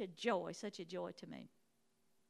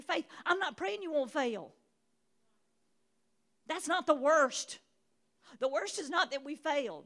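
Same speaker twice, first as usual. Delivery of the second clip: raised preaching voice, building; 5 semitones higher, 7 dB louder than usual. Acted. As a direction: preach it to the congregation.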